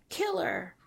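high, jeering call of Killer; called-out taunt. A narrator's reading. Teen trespasser calls out a taunt across the foggy lawn, jeering high call. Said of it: "Then he calls out".

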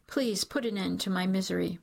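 plain reading voice

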